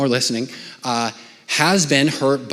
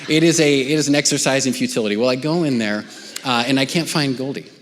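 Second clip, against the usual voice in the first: normal talking voice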